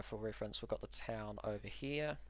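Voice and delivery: usual speaking voice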